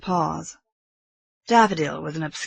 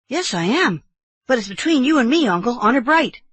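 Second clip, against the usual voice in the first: put-on higher, louder insistent boy; earnest boy nephew; 7 semitones higher, 6 dB louder than usual. Boy binding uncle to secrecy, earnest.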